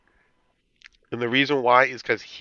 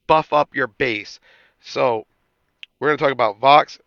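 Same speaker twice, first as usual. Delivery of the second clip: ordinary talk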